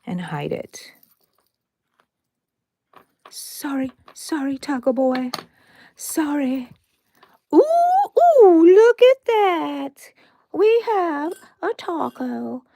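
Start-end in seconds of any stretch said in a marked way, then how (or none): 3.3-6.7 cooing apology. Pretend. To the embroidered Taco Boy, high cooing apology. 7.5-12.6 high, loud, slow delighted ooh. Real, not acted. Showing viewers the finished taco, high delighted gushing.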